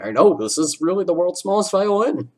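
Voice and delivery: sing-song mockery of someone's sorrow; mocking Mister Krabs. Mister Krabs taunting Squidward, high mock boo-hoo.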